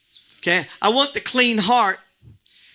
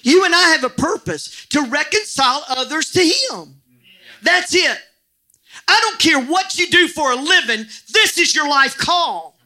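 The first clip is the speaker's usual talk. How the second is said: raised, high, emphatic preaching; yelling preacher; 4 semitones higher, 3 dB louder than usual. Real, not acted. Preacher urging the congregation to reconcile others, yelling. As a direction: drive the call home at high volume. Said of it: "I don't know why I'm yelling at you".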